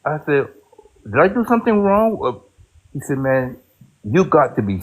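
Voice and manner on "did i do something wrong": ordinary talk, nothing marked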